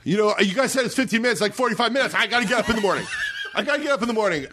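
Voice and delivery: high, fast, laughing exasperation; exasperated complaint. Retold or imitated. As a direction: plead with room service through laughter. Complaining to room service.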